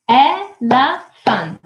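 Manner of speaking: very slow ELEPHANT, e... le... phant; syllable by syllable. Shown as a demonstration. Teacher asking how many syllables, syllable by syllable. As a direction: break elephant into three drawn-out beats. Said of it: "How many syllables do we have?".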